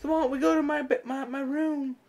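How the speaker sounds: high-pitched imitation of a seductress; mock come-on.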